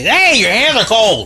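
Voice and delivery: high, startled cry; startled yelp. Real, not acted. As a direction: yelp at cold hands. Startled by cold hands.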